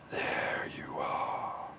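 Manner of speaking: slow, low, whispered menace; deep demon voice. Acted. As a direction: breathe it out like a demon. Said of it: "How do you do that deep voice".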